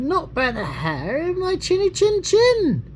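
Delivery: pitched well up, small squeaky cat voice; little cat voice. Acted. Parent reading to child, the little cat refusing the wolf, high squeaky voice. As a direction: answer the wolf as a tiny defiant cat.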